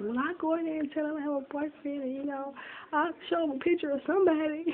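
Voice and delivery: high-pitched, mock-eager offer; imitating girlfriend. Girlfriend's retold eager offer to lie.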